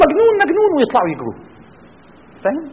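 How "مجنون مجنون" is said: very high mock cry of alarm; jeering crowd. Townspeople fleeing the Prophet and calling names, jeering. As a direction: cry it out like someone running away from him. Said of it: "يجروا منه ويقولوا".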